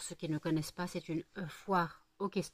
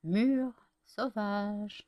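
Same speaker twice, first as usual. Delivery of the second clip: drawn-out announcement; 3 semitones higher, 5 dB louder, much slower than usual. Real, not acted. Announcing the flavour to viewers, slow and drawn out. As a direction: stretch each syllable slowly.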